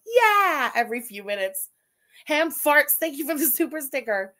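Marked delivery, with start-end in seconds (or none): 0.1-0.7 loud, high mocking "yeah"; imitated reactor. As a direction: blurt it out, mocking a lazy reactor. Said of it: "she just sits there smoking and going".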